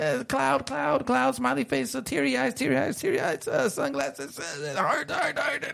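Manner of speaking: rattling off a list in a climbing high voice; breathless high-pitched run-on list; running out of breath. Real, not acted. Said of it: "catch my breath".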